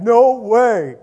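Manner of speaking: loud, high, put-on voice of Nate; Nate's way of saying it. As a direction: mimic his loud surprised no way. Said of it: "That's how he says it".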